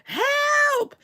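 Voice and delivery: high, drawn-out cry for help; imitated screaming. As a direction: mimic a woman screaming for help. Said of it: "she started screaming".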